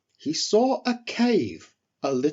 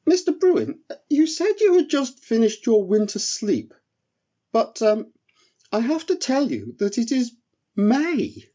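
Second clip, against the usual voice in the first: high, eager little elf voice; a small relieved voice rushing to please; 8 semitones higher than usual. Acted. Eric offers the bear soup, high and relieved.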